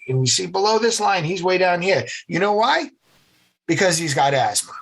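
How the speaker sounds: put-on brisk orders in a nasal New England accent; nasally New England accent. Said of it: "had that nasally New England accent".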